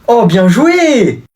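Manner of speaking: high, slow, drawn-out cheer; excited praise. Acted. Father praises his son's header, excited and drawn out. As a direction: cheer him on like a proud dad.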